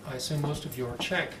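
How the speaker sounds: ordinary talk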